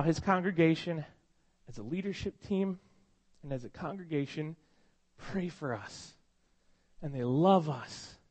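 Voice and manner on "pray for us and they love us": choked up. Real, not acted. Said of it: "Sorry, I'm a little emotional".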